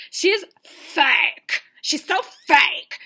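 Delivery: loud, emphatic, stretched-out "fake"; mock venomous accusation. Contestant denouncing her rival to Peter, mock venomous. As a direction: hammer the last word hard and long.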